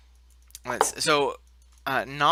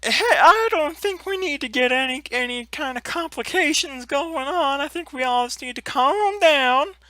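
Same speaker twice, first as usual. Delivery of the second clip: high, gentle giant voice; Grantho; 8 semitones higher, 8 dB louder than usual. Acted. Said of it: "Grantho is like".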